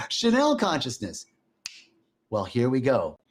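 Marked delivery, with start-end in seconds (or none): 0.1-1.3 amused discovery. Retold or imitated. Amused discovery of own typo.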